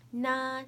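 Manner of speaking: "Na" held high and level; first tone. Shown as a demonstration. Teacher showing the four tones, "Na" high and level. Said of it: "I'll say the four tones".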